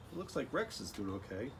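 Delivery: usual speaking voice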